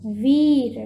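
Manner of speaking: वीर with the ई stretched and loud; drawn-out long ई. Shown as a demonstration. Teacher reads वीर aloud, stretching its long ई. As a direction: hold the ई in वीर. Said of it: "खींच कर बोलना है".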